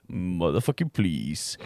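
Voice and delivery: low, slow, drawn-out disbelief; scornful mutter. Retold or imitated. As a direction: drop low and drag the words out. Retold scornful aside to self. (lågt, släpigt och hånfullt)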